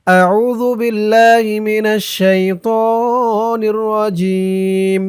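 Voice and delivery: Quran recitation. Shown as a demonstration. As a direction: intone it slowly like tajweed.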